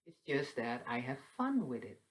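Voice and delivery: ordinary talk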